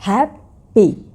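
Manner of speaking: हैप्पी drawn out as "hap... pi", rising then falling; syllable by syllable. Shown as a demonstration. Teacher counting two syllables for learners, slowly split. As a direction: say हैप्पी in two separate beats. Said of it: "इसमें दो syllables हैं".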